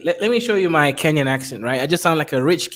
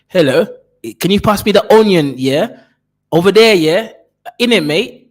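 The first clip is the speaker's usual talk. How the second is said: loud, higher British accent, "In it, mate"; foreign British accent; 5 semitones higher, 7 dB louder than usual. Shown as a demonstration. Host mocks a foreign buyer in a loud British accent. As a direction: ham up a loud foreign tourist. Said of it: "you can't go around sounding like that".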